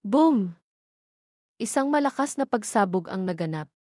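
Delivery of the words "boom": ordinary delivery, nothing marked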